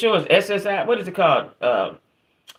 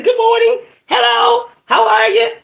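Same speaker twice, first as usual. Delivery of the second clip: much higher, sing-song polite hello; mock sweet greeting; 4 dB louder than usual. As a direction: put on an overly cheerful customer voice, much higher and louder. Mocking own polite greeting.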